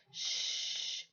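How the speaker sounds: a whispered hush. Acted. Rose hushes the girl through clenched teeth, whispering. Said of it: "Rose whispered through clenched teeth".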